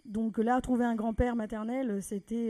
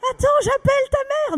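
much higher, loud cry; frantic aunt; 12 dB louder than usual. Retold or imitated. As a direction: shout it excitedly. (tante surexcitée, cri aigu)

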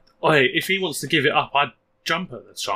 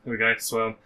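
ordinary talk

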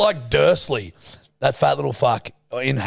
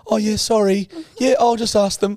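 higher, sheepish imitation of the friend; higher mock apology to the counter clerk; 7 semitones higher than usual. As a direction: play her as meek and apologetic. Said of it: "And you're like".

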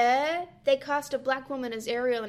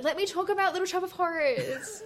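eager pleading; 8 semitones higher than usual. Retold or imitated. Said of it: "I'm like".

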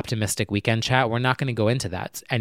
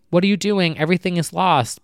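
higher-pitched cartoon dismay; despairing little crab; 6 semitones higher, 4 dB louder than usual. Crab scolding the other after the sandcastle falls, mock despair. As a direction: lament it like a small crab, mock-distraught. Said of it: "the one little crab is saying".

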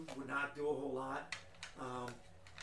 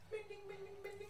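robot chirping; 7 dB quieter, faster than usual. Acted.

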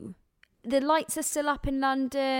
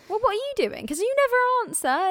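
much higher indignant voice; indignant protest; 8 semitones higher, 6 dB louder than usual. Real, not acted. Friend outraged at an unfair this-or-that, high indignant.

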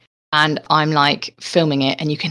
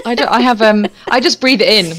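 ordinary talk, nothing marked

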